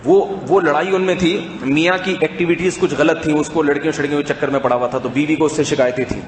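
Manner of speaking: ordinary delivery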